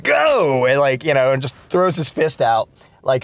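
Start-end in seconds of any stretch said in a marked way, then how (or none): 0.0-0.7 high, excited yell pointing the way; enthusiastic shout. Retold or imitated. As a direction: fling it out like a rallying cry. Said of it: "very enthusiastically".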